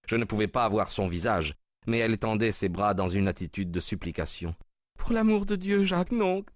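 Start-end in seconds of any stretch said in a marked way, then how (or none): 5.0-6.4 pleading woman's cry. A narrator's reading. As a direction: cry out to stop him, pleading. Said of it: "cria-t-elle". (cri suppliant d'une femme)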